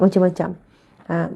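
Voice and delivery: ordinary delivery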